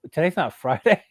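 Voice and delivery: laughing quote. Retold or imitated. Coworker correcting him, laughing. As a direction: chuckle it out as the coworker. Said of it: "He goes".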